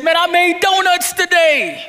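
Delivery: high, bright-eyed proud announcement; excited. Acted. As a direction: sell it like the best news of the day. Voicing proud worker coming home.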